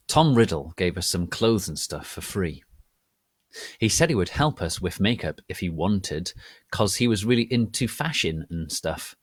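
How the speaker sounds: loud, over-the-top dramatic reading. Acted. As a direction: read the fan fiction like grand theatre. Dramatic reading of fan fiction.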